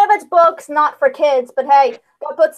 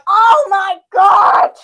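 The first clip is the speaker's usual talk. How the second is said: excited shout; 5 semitones higher, 6 dB louder, much slower than usual. Real, not acted. Reacting to Simpsons clip.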